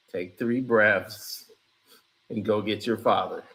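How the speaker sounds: a deep droning self-soothing voice; deep put-on voice. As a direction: mumble it low like a sulky son. Son voice on mental health.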